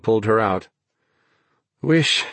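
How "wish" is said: higher voice, words broken by panting; too winded for more than one word at a time. A narrator's reading. Exhausted Naomi wishes for a sled, panting. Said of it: "she panted now".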